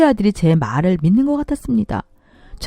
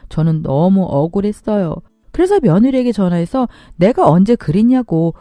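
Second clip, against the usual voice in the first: ordinary delivery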